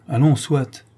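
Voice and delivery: impatient, plus haut et plus fort. A narrator's reading. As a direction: couper court avec agacement. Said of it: "dit impatiemment". (le banquier cède avec impatience, agacé)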